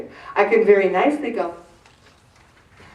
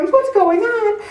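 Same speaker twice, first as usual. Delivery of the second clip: startled question; 12 semitones higher, slower than usual. Retold or imitated. Parents in the stands during the fight, startled. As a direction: call down from the stands in surprise.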